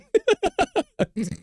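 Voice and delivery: sudden high burst of laughter. Real, not acted. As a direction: laugh out at the dog's reaction. Laughing at Dizzy.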